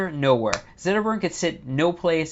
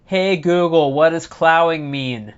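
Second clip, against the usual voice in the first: louder call, slow and drawn out; yelling to another room; 3 semitones higher, 6 dB louder, slower than usual. Retold or imitated. Yelling to Google in the other room, louder and slow. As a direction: call out to a speaker in the next room. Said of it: "I can yell in the other room".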